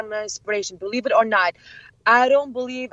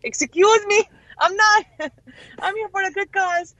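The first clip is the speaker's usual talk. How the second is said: high, playful indignant excuse me; hammed-up objection; mock indignant protest; 9 semitones higher than usual. [name] denying she is famous, high mock indignation.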